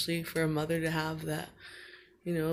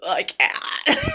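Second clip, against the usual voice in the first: put-on boy's tantrum; 9 semitones higher, 8 dB louder than usual. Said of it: "And you'd be like".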